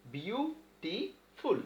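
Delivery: Beautiful very slowly in three separate parts, bi-u, ti, ful; syllable by syllable. Shown as a demonstration. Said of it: "এই যে syllable".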